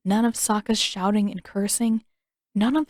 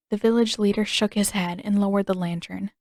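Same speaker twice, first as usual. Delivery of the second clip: ordinary delivery, nothing marked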